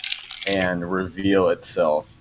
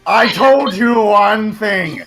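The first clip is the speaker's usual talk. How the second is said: loud, slow, higher shouting in a different voice; furious demon; 11 semitones higher, 6 dB louder, much slower than usual. Acted. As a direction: yell at her for saying his name. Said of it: "Asmonis from within you just says".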